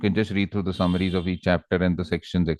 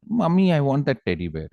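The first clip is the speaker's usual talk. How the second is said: put-on higher, childlike request; little girl asking; 6 semitones higher than usual. Small girl asks her mother for a toy, higher childlike voice. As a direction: plead lightly like a small child. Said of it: "So this girl said".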